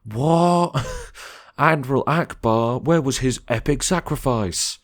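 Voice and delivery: high, squeaky mock outrage; exaggerated nerd whine; mock outraged fan. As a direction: whine like a wounded superfan. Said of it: "That's me, if I'm a loser".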